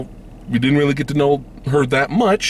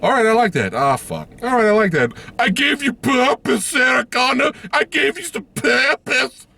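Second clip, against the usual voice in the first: high, raised-pitch mock approval; mock grudging praise; 13 semitones higher than usual. Reviewer thanking the movie, high mock approval. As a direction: praise it like granting a favour. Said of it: "I was like".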